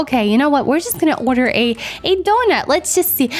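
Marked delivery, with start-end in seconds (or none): none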